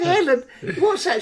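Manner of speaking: high, laughing mock alarm; retold alarm. Asking his girlfriend about the rifle, mock alarm. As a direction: play the startled boyfriend, half laughing.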